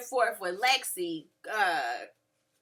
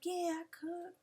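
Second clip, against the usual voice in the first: soft, sly flirt; creep mode; 6 semitones higher, 11 dB quieter than usual. Retold or imitated. Cisco flirting with Mo, soft creep mode. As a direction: purr it like a creep. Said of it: "creep mode".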